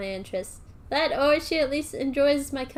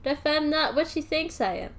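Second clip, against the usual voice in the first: usual speaking voice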